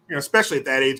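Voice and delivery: usual speaking voice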